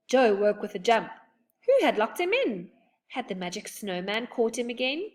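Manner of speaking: ordinary delivery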